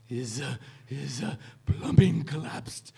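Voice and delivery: stammering professor voice, rising on the punchline; hesitate, then burst out the punchline in a put-on voice; Kubler impression. Retelling the professor's answer about a sick friend, halting imitation. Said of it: "gotta get my Kubler voice back".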